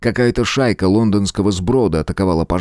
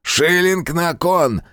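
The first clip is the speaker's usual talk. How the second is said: high, slow hoarse barker's cry; loud carnival barker; 9 semitones higher, slower than usual. Acted. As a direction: call out bets like a fairground barker. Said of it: "выкрикивали на манер ярмарочных зазывал громко и хрипло".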